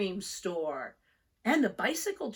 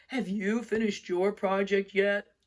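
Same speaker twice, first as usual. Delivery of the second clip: a different, louder big-animal voice; a moose asking a friend; 5 dB louder than usual. Acted. Moose voiced, far timbre.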